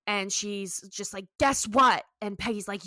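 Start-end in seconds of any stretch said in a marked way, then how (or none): 1.4-2.0 louder, higher burst of news; mildly hammed-up excitement; acted excitement. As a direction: imitate someone giddy with news. [name] announces exciting news.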